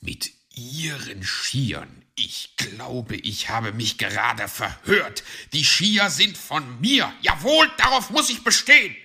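irate fox insisting on ownership; indignant. Acted. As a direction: start measured, then burst into fast protest. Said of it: "zischte Herr Fuchs ungehalten".

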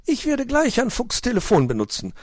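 high, fussing magpie voice; a worried bird asking at the table. Acted. Frau Elster fragt Onkel Uhu besorgt, hohe Elsternstimme.

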